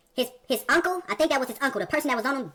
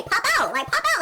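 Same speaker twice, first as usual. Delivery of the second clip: "Pop out" yelled much higher and louder, rising each time; hyped street call; 12 semitones higher, 3 dB louder than usual. Retold or imitated. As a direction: call it out like the crew is rolling up. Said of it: "we say".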